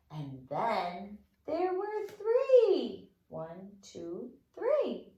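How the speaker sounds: slow sing-song rhyme voice; children's counting rhyme. Acted. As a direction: draw it out for the little ones. Recites counting fingerplay.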